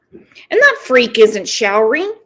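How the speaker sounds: usual speaking voice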